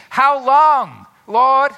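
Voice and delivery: loud, high plea; anguished cry of a struggling believer; lament cry. Acted. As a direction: cry out to God in distress. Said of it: "just have feeling".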